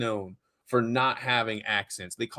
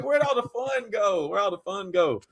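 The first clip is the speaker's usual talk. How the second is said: high-pitched comic complaint; playful high protest; mock lament; 7 semitones higher than usual. Mock lament over lost accent.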